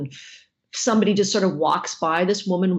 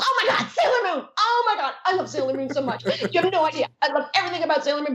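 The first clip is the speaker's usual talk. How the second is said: high squealed gushing, tumbling faster at the end; gushing fangirl; 8 semitones higher, faster than usual. Retold or imitated. Fan meeting Sailor Moon's actor, imitated squealing adoration. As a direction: imitate a starstruck fan, pitched way up. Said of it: "this girl walks by, she goes".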